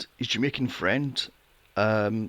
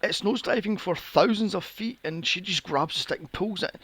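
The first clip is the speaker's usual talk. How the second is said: usual speaking voice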